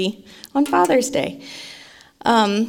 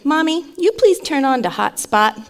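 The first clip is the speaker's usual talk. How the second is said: put-on higher-pitched little boy asking nicely; polite toddler; 5 semitones higher than usual. Three-year-old asking mommy from the car seat, polite little voice. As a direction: imitate a small child's polite request. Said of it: "asked me in his polite little tone".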